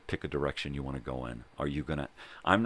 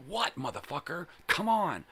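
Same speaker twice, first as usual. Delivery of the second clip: retold aggressive challenge; 14 semitones higher than usual. Painter daring his old boss to fight, high-pitched aggressive challenge. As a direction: pitch leaps high, bursting out on the last words.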